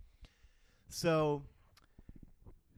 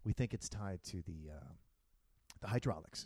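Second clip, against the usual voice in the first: pilot voice; 6 semitones lower, 6 dB quieter than usual. Retold or imitated. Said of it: "and he's like".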